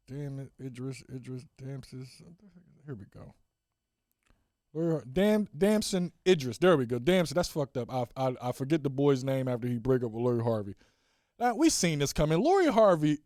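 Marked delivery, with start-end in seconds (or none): none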